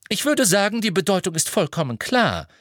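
high, curt woman's voice; brusque. A narrator's reading. McGonagall answers for Marietta, brusque. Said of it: "sagte Professor McGonagall barsch".

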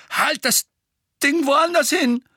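high, loud frightened order; panicked. A narrator's reading. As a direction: yelp it at the wand. Frightened bully at wand point.

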